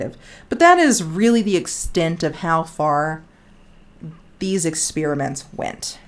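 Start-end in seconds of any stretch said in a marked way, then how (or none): none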